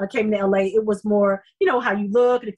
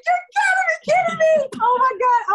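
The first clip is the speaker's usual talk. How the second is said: much higher, loud, near-tearful squeal of joy; overjoyed disbelief; 3 dB louder than usual. Retold or imitated. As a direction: squeal it like you just got the call you prayed for. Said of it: "I was so excited".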